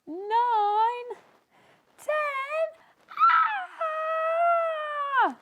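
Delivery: very high, loud shriek; panicked scream. Acted. Sneezy screaming in terror at ten. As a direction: scream and flee.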